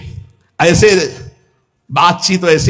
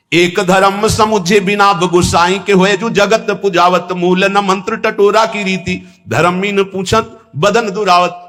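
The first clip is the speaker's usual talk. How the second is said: higher, slow melodic recitation; slow, higher sung-speech verse; 5 semitones higher, slower than usual. Acted. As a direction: lift the verse slowly like a hymn.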